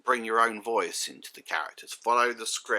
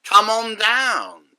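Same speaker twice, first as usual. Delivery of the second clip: booming, slow, much higher game-show call; game show host; 12 semitones higher, 12 dB louder, much slower than usual. Retold or imitated. Quoting the character's game-show line to a witness, booming and slow. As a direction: belt it out like a host calling a contestant down. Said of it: "in one scene he says".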